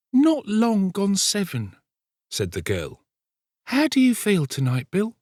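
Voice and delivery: put-on high-pitched young woman in dialogue; the girl's voice. A narrator's reading. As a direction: voice the girl well above the narration. Nancy answers Sikes in dialogue.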